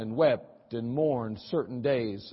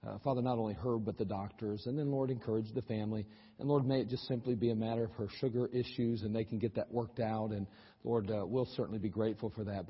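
hushed, soft prayer voice; 6 semitones lower, 9 dB quieter than usual. Real, not acted. Pastor praying over a sick woman, hushed prayer. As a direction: pray quietly and earnestly. Opening prayer before sermon.

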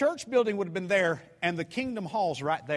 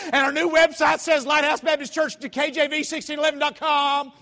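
loud, intimidating; 8 semitones higher, 8 dB louder than usual. Retold or imitated. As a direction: rattle the address off loud and high. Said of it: "it makes you seem really intimidating".

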